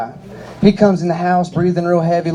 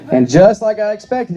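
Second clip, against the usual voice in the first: ordinary talk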